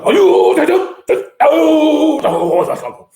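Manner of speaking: high, loud drawn-out rugby call in imitation; mock Japanese lineout calls. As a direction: holler like a parody Japanese quarterback. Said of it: "they did their line out calls".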